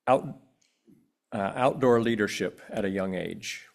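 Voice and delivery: normal talking voice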